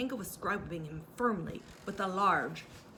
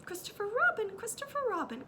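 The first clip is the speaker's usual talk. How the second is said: much higher desperate calls; crying out Piglet; 14 semitones higher than usual. A narrator's reading. Piglet calling to Christopher Robin, much higher. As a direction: call out for rescue. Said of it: "cried Piglet".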